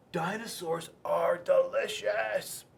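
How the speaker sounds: slow, breathy whispered gloat; sly whisper. Acted. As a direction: whisper it like a secret. Goldfish's sly punchline.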